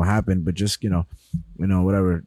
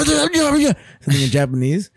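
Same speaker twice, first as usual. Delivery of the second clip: mock Japanese host; 9 semitones higher than usual. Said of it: "he'll go".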